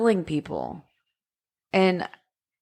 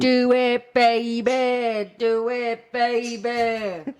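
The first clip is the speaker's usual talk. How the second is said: gravelly monotone rock singer; 5 semitones higher, much slower than usual. Retold or imitated. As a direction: growl it like a rock frontman. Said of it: "like, a gravelly voice".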